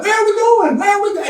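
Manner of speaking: high, repeated calls; calling down the line. Acted. People in line calling one by one, high. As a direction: echo the question down the line.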